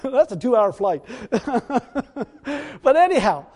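real laughter through the words; laughing. Real, not acted. Preacher enjoying his own joke about remote Texas, laughing.

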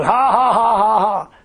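loud, drawn-out fake belly laugh; put-on run of ha-ha-ha; forced laughter. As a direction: force out a laugh you don't feel. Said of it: "I didn't feel like laughing".

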